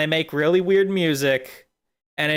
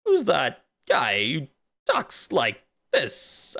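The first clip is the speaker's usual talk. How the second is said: slow, halting actor impression; Christopher Walken; 3 semitones lower, much slower than usual. Streamer asks who talks like this, halting impression. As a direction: break it up with long odd pauses. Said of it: "Christopher Walken".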